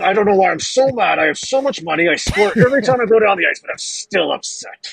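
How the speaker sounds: high, hammed-up angry player voice; mock angry MacKinnon. Host mocking an angry star player, hammed-up grumble. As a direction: play him as a grumpy superstar for laughs. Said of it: "He's like".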